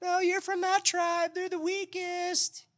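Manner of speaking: high, slow taunting sneer; mocking bully. Bullies mocking his tribe, a high slow taunt. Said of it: "some bullies ... made fun".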